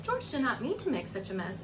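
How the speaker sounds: ordinary delivery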